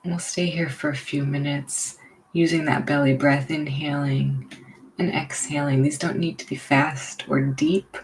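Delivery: unhurried, leave room for each breath; guided meditation. Real, not acted. Leading listeners into belly breathing, guided meditation.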